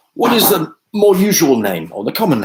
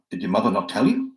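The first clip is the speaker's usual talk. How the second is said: normal talking voice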